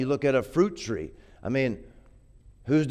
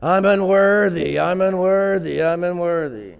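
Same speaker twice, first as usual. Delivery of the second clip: high, loud, slow groveling whine; pleading loudly, fading toward the end; mock groveling; 4 semitones higher, 5 dB louder than usual. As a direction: ham up a servant begging on his knees. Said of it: "got down on his knees and said".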